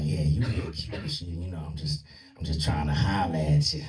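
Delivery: low, rough voice imitating a stud; rough-voiced stud. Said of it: "she had that rough voice".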